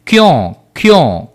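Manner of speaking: drawn-out teacher's demonstration; slow word modelling. Shown as a demonstration. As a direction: say each word slowly and separately. Teacher models the new Hindi word.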